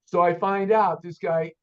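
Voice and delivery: ordinary talk, nothing marked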